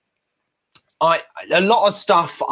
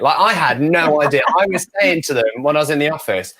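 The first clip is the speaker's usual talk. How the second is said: ordinary talk, nothing marked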